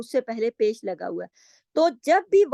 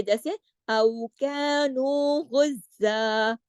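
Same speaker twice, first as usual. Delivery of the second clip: slow chanted recitation ending in गुज़्ज़ा with a long a; recitation with a stop; much slower than usual. Shown as a demonstration. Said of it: "ये works की सूरत में है".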